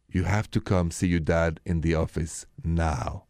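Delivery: low, slow and measured summons; deliberate, low-pitched parental reprimand without yelling; slow disciplining dad. Retold or imitated. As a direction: imitate a dad calmly disciplining, very slowly. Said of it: "start to talk very slowly".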